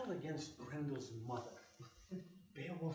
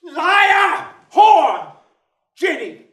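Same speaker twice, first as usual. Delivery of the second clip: furious high screams; screaming; 13 semitones higher, 26 dB louder, much slower than usual. Acted. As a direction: scream each word at full force. Father screams insults.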